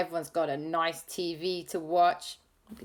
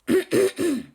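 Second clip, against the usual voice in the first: throat clear; 12 semitones higher, 7 dB louder than usual. Real, not acted. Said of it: "regret doing Gru's voice".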